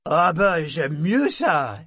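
vieux matelot ravi, lent et traînant; ton approbateur. A narrator's reading. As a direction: approuve en savourant. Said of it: "fit-il d'un ton approbateur". (matelot content de l'offre de rhum)

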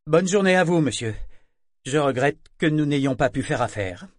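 breezy mocking farewell, starting high and loud; playful, mocking. A narrator's reading. Job-seeker bluffing as he leaves the agent, playfully mocking. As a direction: toss off a teasing goodbye. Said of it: "prendre un ton à la fois espiègle et moqueur".

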